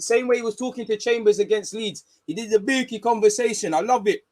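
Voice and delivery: high-pitched, excited gushing; elated fan praise. Real, not acted. Fan praising Arteta after a goal, elated.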